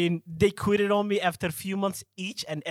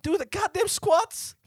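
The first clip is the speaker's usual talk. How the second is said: loud, high-pitched hammed-up rant; over-the-top tough-love coach tirade; mock threatening trainer; 10 semitones higher, 4 dB louder than usual. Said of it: "I'm like".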